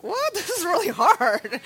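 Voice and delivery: high imitation of baffled kids, laughing; laughing mock complaint. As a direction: whine it playfully through a laugh.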